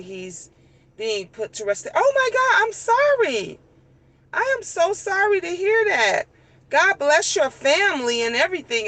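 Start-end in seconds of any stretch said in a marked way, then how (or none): 1.9-8.9 high, sudden gasp of sorry; high, gushing sympathy; shocked sympathy. Retold or imitated. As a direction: gush your condolences, pitched way up. Sympathy to grieving customer.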